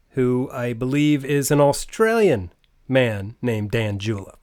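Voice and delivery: ordinary talk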